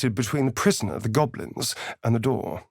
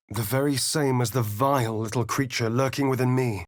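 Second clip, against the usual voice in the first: ordinary talk, nothing marked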